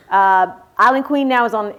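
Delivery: normal talking voice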